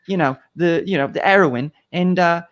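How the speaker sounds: Russell Brand. Retold or imitated. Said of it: "his accent and everything".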